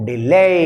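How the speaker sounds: "delay" with a long, emphatic "lay"; stress on second syllable. Shown as a demonstration. Teacher saying see how I pronounce, second syllable stressed. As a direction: lean hard on "lay" in "delay". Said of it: "See here, I am pronouncing".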